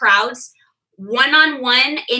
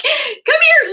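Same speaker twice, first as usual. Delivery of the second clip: high, louder cooing call; mock coaxing; 5 dB louder than usual. Hostess to scared guest, hammed-up coaxing. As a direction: call over a timid child in a playful high voice.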